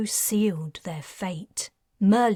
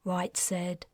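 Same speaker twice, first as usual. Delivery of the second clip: usual speaking voice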